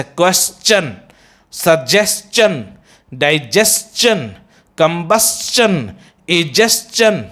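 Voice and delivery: "क्वेश्चन, सजेशन, डाइजेशन, कंबस्चन, egestion" slowly, each ending "s-chun"; teacher's slow word list, "chun" not "shun"; -stion said as "chun". Shown as a demonstration. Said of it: "बल्कि चन बोलते हैं".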